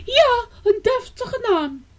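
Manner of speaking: high, proud little bird voice; mother sparrow. A narrator's reading. Mother sparrow insisting on a posh name, high-voiced. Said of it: "zei Ibekwie".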